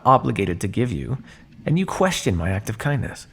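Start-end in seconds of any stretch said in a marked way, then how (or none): none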